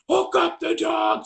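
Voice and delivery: very high, drawn-out mock scream; freaking out. Panicked cops cutting off the trainer, retold screaming. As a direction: mimic a frantic yell cutting in. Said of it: "absolutely freaking out".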